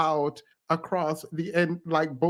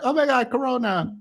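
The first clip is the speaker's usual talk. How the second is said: mock alarm; 7 semitones higher, faster than usual. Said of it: "and then I was like".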